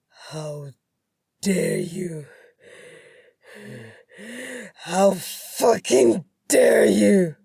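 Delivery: outraged yell, words dragged out; enraged yelling. A narrator's reading. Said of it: "My husband kept yelling".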